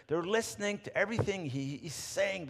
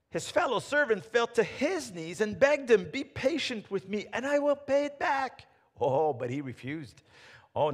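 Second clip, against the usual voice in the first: scripture reading; 4 semitones higher, 6 dB louder than usual. Acted. Continues reading the parable.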